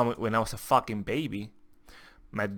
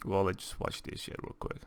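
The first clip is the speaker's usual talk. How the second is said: normal talking voice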